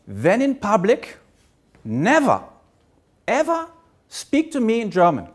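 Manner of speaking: put-on German-accented English, strict father; a hint of a stern German dad; father's German accent. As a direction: quote the father in a light German accent, firm. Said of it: "I'll say this with my father's accent".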